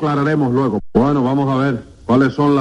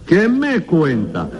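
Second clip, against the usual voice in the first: drawn-out, higher-pitched disbelief; mock astonishment; 4 semitones higher, much slower than usual. Judge hearing an absurd excuse, incredulous. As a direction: stretch it out, astonished.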